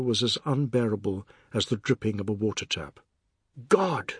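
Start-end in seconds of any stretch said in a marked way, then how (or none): 3.7-4.1 sudden higher exclamation; one exasperated outburst; crying out. A narrator's reading. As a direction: cry it out sharply in exasperation. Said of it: "Mister Biswas cried".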